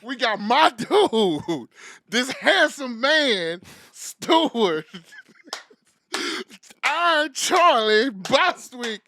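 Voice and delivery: loud, slow, drawn-out announcing; hype announcement. Acted. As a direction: bellow it like a ring announcer. Hype man announcing guest.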